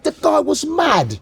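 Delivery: high, slow mock British dad; a lightly put-on British father; British dad quote. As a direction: play the proper British father, slowly.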